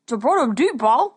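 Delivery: loud garbled words round food; mouth full. A narrator's reading. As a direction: talk through a mouthful of eggs. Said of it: "said Wallace through a mouthful of eggs".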